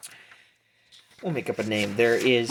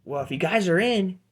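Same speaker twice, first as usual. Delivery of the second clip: higher halfling hustler; Pete; 7 semitones higher, 3 dB louder, faster than usual. Acted. Halfling bookie to the new fighters, urging hustler's voice. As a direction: raise the voice, urging them on. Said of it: "And Pete goes".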